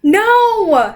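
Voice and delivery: high loud desperate scream. A narrator's reading. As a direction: scream no with all her might. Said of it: "she screamed again".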